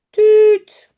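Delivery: high, loud whistle toot; imitated whistle. Chef blows his whistle.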